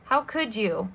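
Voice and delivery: ordinary delivery, nothing marked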